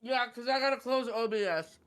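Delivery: talking through a mouthful of food; words round a bite of food; mouth full. Real, not acted. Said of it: "gotta not talk with your mouth full".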